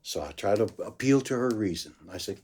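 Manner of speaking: normal talking voice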